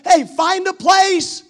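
rally the congregation at top voice; full-force preaching. Acted.

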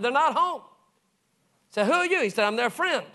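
high animated exchange. Retold or imitated. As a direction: pitch the voices up in quick dialogue.

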